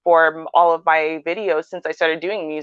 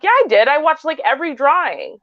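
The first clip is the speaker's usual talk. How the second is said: insistent; 7 semitones higher than usual. Retold or imitated. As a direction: insist you never miss one. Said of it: "I'm like".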